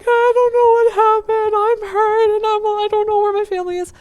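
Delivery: high, loud mock wail of a panicked child; hysterical little kid. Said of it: "being a hysterical little kid".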